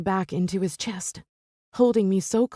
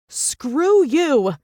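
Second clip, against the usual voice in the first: high, loud furious bellow; screaming in his face; 7 semitones higher, 6 dB louder than usual. Acted. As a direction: hurl it at him, full-throated. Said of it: "I bellow".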